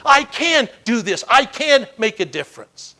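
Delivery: loud, slow, high stress on "can"; determined. Acted. People with grit affirm themselves, determined "I can".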